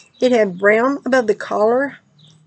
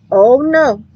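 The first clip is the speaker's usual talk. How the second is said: louder, firm commanding tone; voice of authority; 4 semitones higher, 5 dB louder than usual. Retold or imitated. A dreamer firmly checking a growling dog, commanding voice. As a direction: put the dog in its place. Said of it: "I said in a voice of authority".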